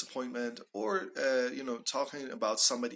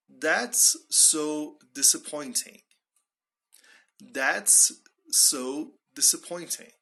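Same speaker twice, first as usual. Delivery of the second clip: slow, deliberate, spaced-out words; slow model phrase; 2 semitones higher, 4 dB louder, slower than usual. Shown as a demonstration. Teacher models a direct expression, slowly and deliberately. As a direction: draw out "That's so disappointing".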